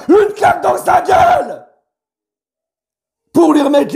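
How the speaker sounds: furious outburst. Real, not acted. Fan furious at Kylian's attitude, shouting. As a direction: blow up at him. Ranting at Mbappé.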